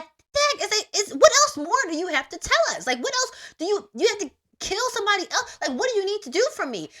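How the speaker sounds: high, loud exasperated complaint at the show; exasperated outburst. Retold or imitated. Complaining about a long series, high and loud exasperation. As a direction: throw up your hands at the show dragging on. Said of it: "We got to episode eleven and was like".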